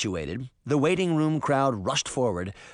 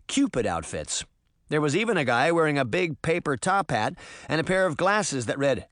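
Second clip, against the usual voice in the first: plain reading voice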